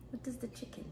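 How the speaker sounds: whispered coaxing to a pet. Shown as a demonstration. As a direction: whisper the question gently. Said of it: "practice your animal sounds".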